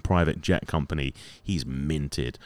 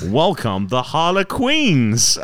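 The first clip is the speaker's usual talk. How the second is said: drawn-out, rising-pitch mock announcer; 7 semitones higher, much slower than usual. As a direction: herald the team with a stretched last word. Imitates bar announcing the team.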